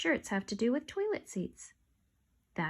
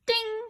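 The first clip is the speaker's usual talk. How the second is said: chime ding; 11 semitones higher than usual. Acted. Sale notification sound.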